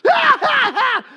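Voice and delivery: very high, loud shouting imitation; loud noisy roommate. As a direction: mimic a roommate yelling while you study. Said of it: "in another room just going".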